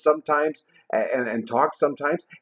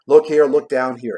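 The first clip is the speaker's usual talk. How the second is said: louder, urgent exclaim; exclamation; 7 dB louder than usual. A narrator's reading. Ghost urging Scrooge to see the children, loud exclaim. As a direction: call Scrooge's eyes down sharply. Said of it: "exclaimed the ghost".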